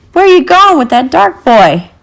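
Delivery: a soldier yelling a challenge. A narrator's reading. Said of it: "one yelled at me".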